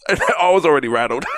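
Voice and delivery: usual speaking voice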